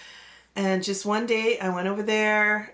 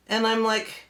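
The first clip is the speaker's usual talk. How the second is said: ordinary delivery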